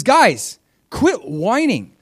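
scolding. Retold or imitated. Prophet scolding the people.

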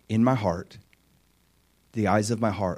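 ordinary talk

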